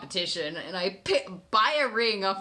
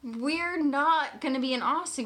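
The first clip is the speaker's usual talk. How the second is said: shocked; 7 semitones higher than usual. Retold or imitated. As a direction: gasp it out in surprise. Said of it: "And I'm like".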